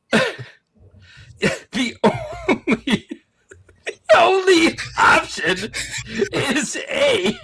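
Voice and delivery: high-pitched laughing shout of the answer. Real, not acted. Streamer laughing as he tells viewers the answer, laughing. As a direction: crack up while insisting on the answer.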